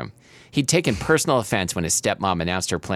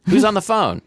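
calling out; 11 semitones higher, 5 dB louder than usual. Acted. Dad calls out to son.

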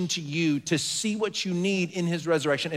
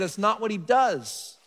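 usual speaking voice

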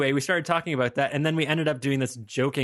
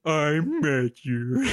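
slow, badly faked Swedish accent; hammed-up Swedish accent; 3 semitones higher, much slower than usual. Shown as a demonstration. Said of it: "Ooh, do it".

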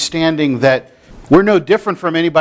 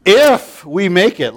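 a touch louder, slow doubtful if; mock unromantic fiance; 2 semitones higher, 3 dB louder, slower than usual. Fiance doubting to his bride-to-be, mock hesitant. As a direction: drag out the if, unsure and deadpan. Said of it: "isn't that the most romantic thing".

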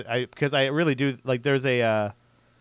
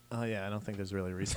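mumbled; 8 semitones lower, 16 dB quieter, faster than usual. Retold or imitated. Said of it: "I'm like".

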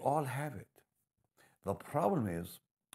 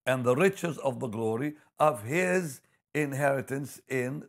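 louder and higher, building preacher fervour; fervent scripture reading; 6 semitones higher, 8 dB louder than usual. Acted. Preacher reading Ephesians to the congregation, proclaiming loudly. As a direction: proclaim the verse with mounting force.

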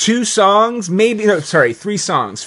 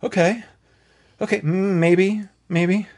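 higher, hesitant, questioning; tentative doubt; 4 semitones higher than usual. Retold or imitated. Viewer reading the lyrics after the movie, tentative doubt. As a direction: weigh it up, unconvinced.

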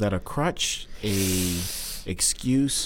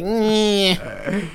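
unimpressed noise; 10 semitones higher, 3 dB louder than usual. Retold or imitated. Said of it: "So I'm like".

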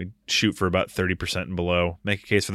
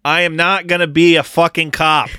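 imitating a movie line, much higher and louder; mock Matt Damon yelling about not being a cop; 8 semitones higher, 7 dB louder than usual. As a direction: belt it out like a defiant movie hero. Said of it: "all I was needing was Matt Damon to say".

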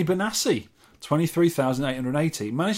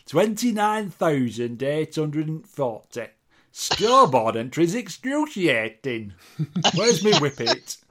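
slow broad Yorkshire voice reading out the scores; hammed-up Yorkshire, drawn out; Yorkshire accent; 2 semitones higher, 3 dB louder, slower than usual. Host reads a listener's score in Yorkshire accent. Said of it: "you've gotta do it in a proper Yorkshire accent".

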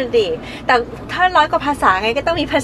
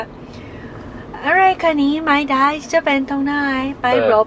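foreigner speaking Thai; 2 semitones higher, much slower than usual. Retold or imitated. Imitating a Western actor's Thai, mock farang accent. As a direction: stumble through Thai like a Westerner. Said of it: "ฝรั่งพยายามพูดภาษาไทย".

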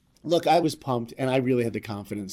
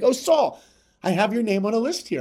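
voiced executive; 10 semitones higher, 4 dB louder than usual. Disney head voiced on phone.

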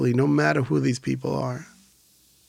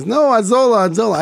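insistent correction; 9 semitones higher, 6 dB louder, faster than usual. Retold or imitated. Caller correcting the misheard name.

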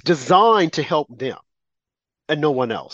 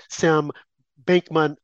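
normal talking voice

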